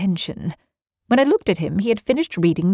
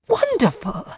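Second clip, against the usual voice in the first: high, amazed exclamation; exclaiming; 7 semitones higher than usual. A narrator's reading. Watson marvels at Holmes's deduction, exclaiming. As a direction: burst out in admiration. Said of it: "I ejaculated".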